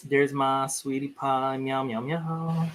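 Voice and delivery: drawn-out coo to her sweetie pie; cooing to the cat. Real, not acted. Greeting her cat on stream, slow tender coo.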